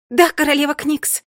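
loud, eager yes; eager shout. Acted. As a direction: snap to attention and shout it. Eager reply to the queen.

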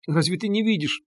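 high, hushed motherly alarm. A narrator's reading. Frightened mother rushing to her daughter, alarmed. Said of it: "перепугалась мать".

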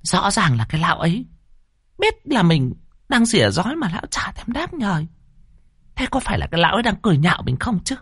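high, sour resentful grumbling to herself; fuming neighbour woman. Acted. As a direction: stew in suspicion like a petty village shrew. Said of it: "mụ lại càng tức tối".

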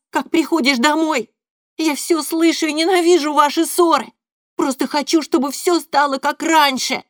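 put-on tearful shouting boy. A narrator's reading. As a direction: pour it all out, crying. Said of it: "договариваю сквозь слёзы".

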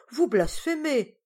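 slow, high, loud protest; shocked exclamation. A narrator's reading. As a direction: cry out in mock-scandalised protest. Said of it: "s'écria Jacobéa".

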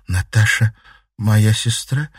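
ordinary delivery, nothing marked

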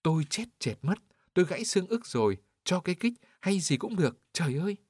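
put-on high, pained wail of an old man trapped under a cart; moaning and wailing. A narrator's reading. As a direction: cry out in pain, voice climbing high. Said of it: "vừa rên vừa rú". (rên rỉ kêu la, giọng cao vút vì đau)

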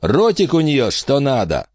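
sleazy grin in the voice; leering thug. Acted. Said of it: "отозвался Джексон".

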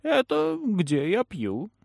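high, slow and matter-of-fact; casually chilling old crone; Ламия. A narrator's reading. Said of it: "как она это сказала, будто это самая естественная". (небрежно, жутко спокойно)

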